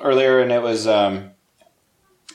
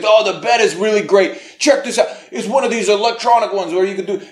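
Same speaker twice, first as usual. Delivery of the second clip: a hint of a pushy salesman, higher; salesman pitch; 7 semitones higher than usual. Grandpa showing off a bed at an estate sale, lightly voiced salesman. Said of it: "he's like".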